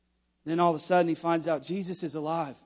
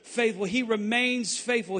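normal talking voice

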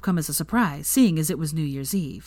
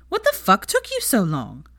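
brassy Pansy; 4 semitones higher than usual. A narrator's reading. Pansy after greeting.

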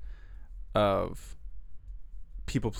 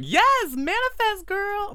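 gushing cheer; 5 dB louder, slower than usual. Retold or imitated. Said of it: "I'm gonna be like".

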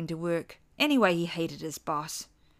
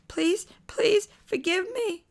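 put-on pleading child voice; 14 semitones higher, 4 dB louder, slower than usual. A narrator's reading. Father pleading again.

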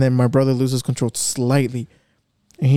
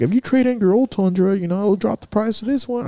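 higher-pitched imitation of a car dealer; salesman pitch; 6 semitones higher than usual. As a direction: coax like a dealer offering a deal. Acted quote of car salesman.

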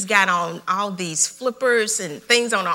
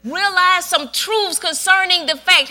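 full-force preaching; 9 semitones higher, 6 dB louder than usual. Acted. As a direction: preach it straight at the congregation.

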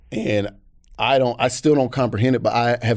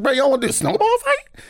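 put-on high-pitched boyish excitement; eager invitation. Big kid inviting grown men to play, high and eager.

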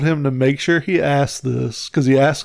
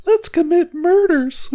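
very high, slow little creature voice; high-pitched cartoon penguin; slower than usual. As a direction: squeak it out high and drawn-out. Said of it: "like, high voice".

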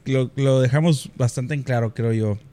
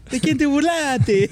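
high laughing tease; slower than usual. Real, not acted.

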